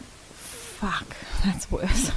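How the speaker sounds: whispered curse breaking into a laugh; whispered dismay. Retold or imitated. Parents hearing it was a different baby, whispered dismay. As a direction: swear under your breath, half laughing. Retelling bad news with a laugh.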